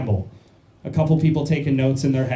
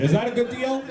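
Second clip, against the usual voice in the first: higher, rising hopeful question; pleading question; 7 semitones higher than usual. Pretend. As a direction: pitch it up into an eager ask. Haggling with the crowd over laughs.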